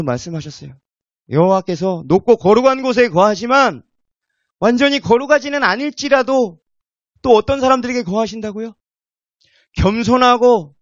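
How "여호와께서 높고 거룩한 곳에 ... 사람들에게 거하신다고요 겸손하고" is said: loud, high scripture proclamation; full-force preaching. Acted. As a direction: proclaim the verse out to the whole congregation. (성경 말씀을 힘차게 선포하는 설교)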